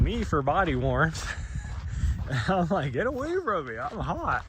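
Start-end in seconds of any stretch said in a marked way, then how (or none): 2.9-4.4 high, exasperated complaint; annoyed protest. Retold or imitated. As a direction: push her away in mock annoyance. Annoyed at dog for body warmth.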